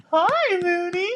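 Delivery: very high, drawn-out cooing. Real, not acted. Parents greeting their baby.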